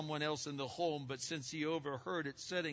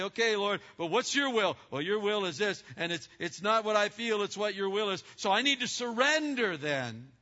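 louder and higher, prayerful resolve; believer praying; 7 semitones higher, 7 dB louder than usual. Retold or imitated. Believer asking the Lord his will, earnest prayer. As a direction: pray it with resolve.

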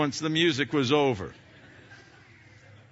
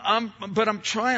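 normal talking voice

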